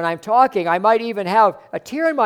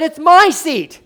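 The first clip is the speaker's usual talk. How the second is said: higher, louder usher yelling it's his seat; 10 semitones higher, 9 dB louder, slower than usual. Retold or imitated. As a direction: bark it out, possessive and indignant. Usher repeats, now much louder.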